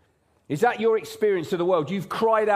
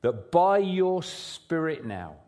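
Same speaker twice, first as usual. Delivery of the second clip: prayer; 5 semitones lower than usual. Real, not acted. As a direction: pray it gently and sincerely. Pastor prays aloud before sermon.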